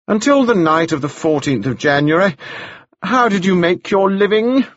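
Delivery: pointed, probing barrister's question; lightly voiced prosecutor interrogating. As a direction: press the witness with a leading question. Broom cross-examines Anna.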